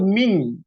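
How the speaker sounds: "ming" with a clear held NG; ng ending. Shown as a demonstration. Teacher spelling it Indonesian-style, "ming" with clear NG. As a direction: end "ming" on a firm NG hum. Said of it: "Bacanya itu".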